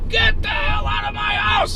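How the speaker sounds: high, strained shout of an irate homeowner; furious yell. Retold or imitated. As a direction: scream it to drive someone out. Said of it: "He's yelling at me".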